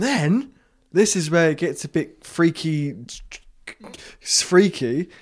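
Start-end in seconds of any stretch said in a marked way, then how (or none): none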